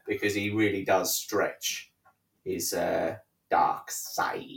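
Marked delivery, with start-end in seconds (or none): 3.5-4.4 whispered sinister Palpatine voice; breathy dark side hiss; pompous senator oratory. Retold or imitated. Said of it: "taking the piss out of Senator Palpatine's voice".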